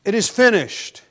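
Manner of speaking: high, loud proclamation; preacher voicing a triumphant last cry; loud shout. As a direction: shout it out as a final declaration. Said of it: "with a loud shout".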